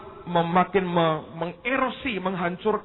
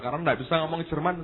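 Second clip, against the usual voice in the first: ordinary talk, nothing marked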